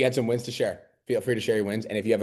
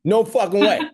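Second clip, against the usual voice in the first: much higher, louder, laughing "No fucking way"; laughing exclamation; 12 semitones higher, 9 dB louder than usual. Real, not acted. Coach scoffing at the idea of answering everyone, loud laughing. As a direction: belt it out through a laugh.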